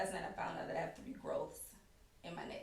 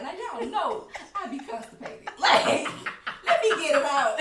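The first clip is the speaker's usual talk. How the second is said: laughing excuse; 6 semitones higher, 12 dB louder than usual. Retold or imitated.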